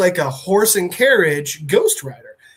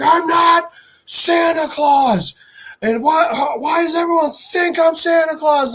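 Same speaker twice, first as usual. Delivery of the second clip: high, strained villain yell; acted exasperated shout; 11 semitones higher than usual. Villain denies being Santa to heroes, acted exasperated shout. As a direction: protest it as a hammed-up villain.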